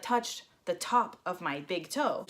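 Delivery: usual speaking voice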